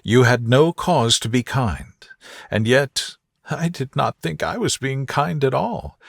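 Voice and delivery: plain reading voice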